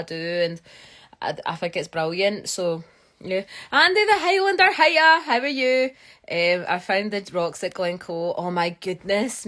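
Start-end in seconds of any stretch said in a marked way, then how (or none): 3.7-5.9 high, loud delighted hello; excited greeting. Real, not acted. Livestreamer hailing a viewer who just joined, excited. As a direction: sing out a bubbly welcome.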